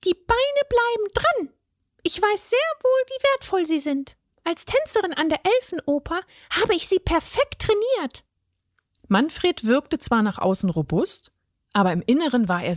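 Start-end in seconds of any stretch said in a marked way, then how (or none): 0.1-8.2 very high fairy voice, proud; a ballet fairy defending her legs. A narrator's reading. The fairy lectures the troll about her legs, high and proud.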